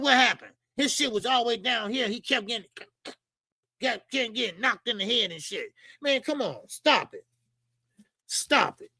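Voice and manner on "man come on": ordinary delivery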